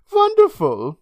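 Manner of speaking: put-on high, loud European-accented delight; hammed-up European accent. A narrator's reading. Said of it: "in her heavy European accent".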